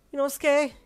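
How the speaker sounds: high, loud frightened call; alarmed cry for help; trembling voice. Acted. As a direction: call out in fear. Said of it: "this time your voice trembling".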